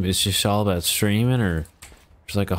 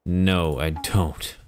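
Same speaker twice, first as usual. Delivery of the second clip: usual speaking voice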